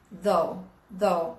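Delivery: "though" said low and falling, twice. Shown as a demonstration. Teacher drilling the low falling though. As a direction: drop each "though" low. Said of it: "Ele cai".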